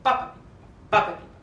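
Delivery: loud punched "pa" and "pi"; accented syllables demo. Shown as a demonstration. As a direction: hit each accent hard. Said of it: "put an accent on every F".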